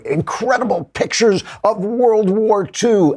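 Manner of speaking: high, slow, breathless-wonder headline voice; mock-amazed, stretched-out announcement; clickbait headline hype. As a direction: hype it like a lurid clickbait teaser.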